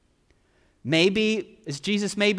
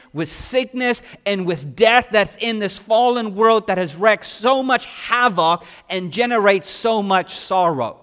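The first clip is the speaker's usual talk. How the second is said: ordinary talk, nothing marked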